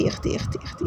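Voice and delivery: whispered exasperation under the breath. Real, not acted. Still at the stove, she whispers her dismay. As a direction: breathe it out to herself, voiceless.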